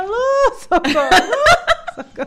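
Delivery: high, loud, slow drawn-out call for help; half-laughing mock labour scream; retold cry for help. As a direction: wail it out laughing at yourself. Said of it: "porque eu gritava".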